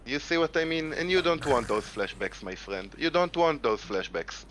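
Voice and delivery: slow, deliberate Israeli-accented English; mock-warn them in a slow Israeli accent; put-on Israeli accent. Shown as a demonstration. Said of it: "start with the accent now".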